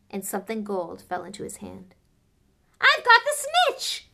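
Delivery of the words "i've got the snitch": high, loud victorious yell; Harry yelling to the crowd; triumphant shout. Acted. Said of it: "he shouted".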